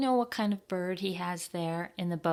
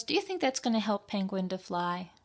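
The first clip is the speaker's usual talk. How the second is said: ordinary delivery, nothing marked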